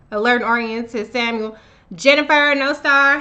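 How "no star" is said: ordinary delivery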